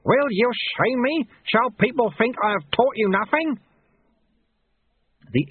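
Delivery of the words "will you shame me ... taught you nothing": high, indignant scolding; outraged teacher, pitched way up; roaring master. A narrator's reading. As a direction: berate the pupil in a high voice. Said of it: "Lutze roared".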